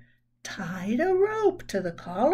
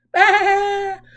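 higher, loud playful bleat of a vote; cheerful loud bah; 6 semitones higher, 9 dB louder than usual. Acted. Girl voting for pizza with a loud bah. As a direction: cast the vote like a sheep, loudly. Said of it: "said Amelia Bedelia".

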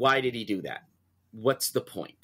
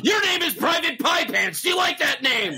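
drill sergeant shout; 13 semitones higher than usual. Acted. Full Metal Jacket drill sergeant.

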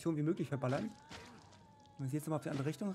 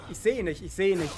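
loud, urgent, higher repeat; alarmed; 4 semitones higher, 10 dB louder than usual. Real, not acted. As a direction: blurt it out in a panic. Lost enemy, alarmed.

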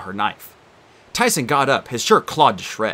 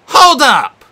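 loud sharp "hold up"; shouting; 13 semitones higher, 12 dB louder than usual. Acted. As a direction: shout to stop her. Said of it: "Annabeth shouted".

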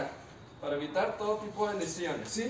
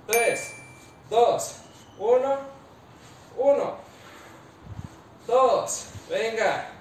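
barking gym coach; 2 semitones higher, 7 dB louder, much slower than usual. Real, not acted. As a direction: shout each count to push the class. Coach counting reps aloud.

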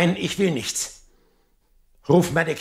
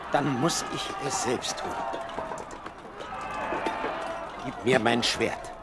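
lower, softer, slowing resolve; grim resignation; 4 semitones lower, 7 dB quieter than usual. Acted. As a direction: sink into a quiet final decision. King resolves to kill himself. (leise, gefasste Resignation)

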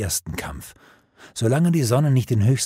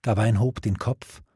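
ordinary delivery

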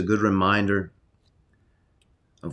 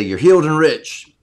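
loud, high, jubilant declaration; yelled out loud; 7 semitones higher, 9 dB louder than usual. Shown as a demonstration. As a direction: belt it out for everyone around to hear. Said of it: "I yell it out loud too".

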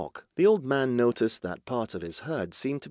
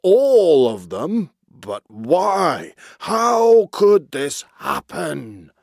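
put-on loud, drawn-out dismay in an old-boss voice; astonished cry; 5 semitones higher, 10 dB louder, much slower than usual. A narrator's reading. Old walrus chief deserted by his herd, loud astonished cry. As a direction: wail each question in disbelief. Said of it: "he cried".

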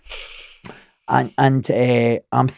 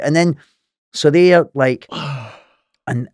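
ordinary talk, nothing marked

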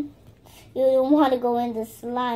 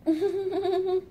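short, higher-pitched play giggle; 4 semitones higher than usual. Pretend. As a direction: giggle at the puppy's antics. Toy play, laughing at puppy.

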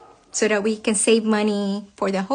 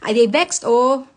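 louder Nigerian Pidgin "I dey vexed", rising on "vexed"; 2 semitones higher, 3 dB louder than usual. Real, not acted. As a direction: declare it in Nigerian Pidgin, annoyed. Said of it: "As you can tell, I am pissed off".

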